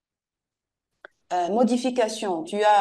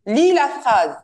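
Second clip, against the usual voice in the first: louder, sharp order; stern command; 4 semitones higher, 7 dB louder, slower than usual. Shown as a demonstration. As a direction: bark the order at the pupil. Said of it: "de trois tons différents". (ordre sévère et fort)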